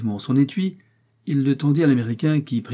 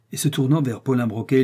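ordinary delivery, nothing marked

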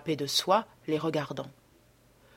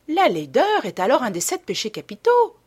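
raised, louder protesting exclamation; exclaiming; 6 semitones higher, 5 dB louder than usual. A narrator's reading. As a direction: cry it out as the duchess, higher and louder. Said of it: "s'écria la duchesse".

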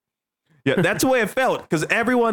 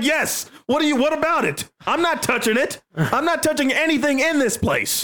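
higher, nervous protest, mock-scared; high anxious self; 7 semitones higher than usual. To friend about the purse, higher nervous refusal. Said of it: "I'm like".

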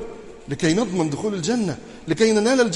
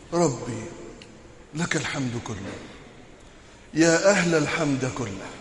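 prayer; 4 semitones lower, 4 dB quieter than usual. Acted. Closing supplication.

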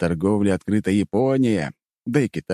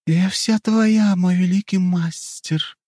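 high, slow, languid seductress; sultry; 12 semitones higher, slower than usual. Acted. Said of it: "томным голосом произнесла тёмная эльфийка".

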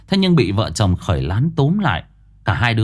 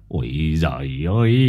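stunned grandpa; 7 semitones lower, 3 dB quieter than usual. A narrator's reading. Grandpa shocked, face twitching.

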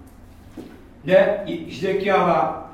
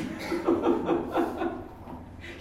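soft rolling chuckle; laugh; 5 dB quieter than usual. Real, not acted. Joking that men are weak, soft chuckling. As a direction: laugh quietly at your own joke.

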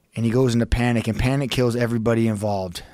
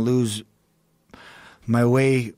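ordinary talk, nothing marked

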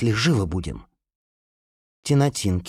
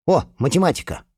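perked up; 7 semitones higher than usual. Acted. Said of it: "оживился Марк".

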